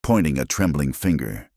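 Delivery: plain reading voice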